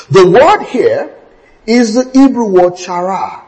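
usual speaking voice

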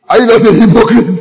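loud, high challenge through amusement; mock accusation. Preacher teasing a congregation as hypocrites, loud amused mock accusation.

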